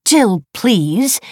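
high, loud, indignant echo; offended. A narrator's reading. Wife echoing husband's brush-off, offended. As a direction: throw it back as an affronted question. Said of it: "she said, offended".

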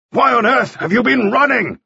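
high, exasperated shouted question; angry shout. A narrator's reading. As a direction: yell at the boy who should not have run. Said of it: "Mannering shouted".

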